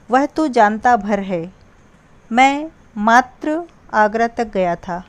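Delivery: slow, with भर and मात्र punched; emphasis on भर, मात्र; stress on भर and मात्र. Shown as a demonstration. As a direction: press on भर and मात्र. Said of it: "भर और मात्र शब्द पर बल देने के कारण".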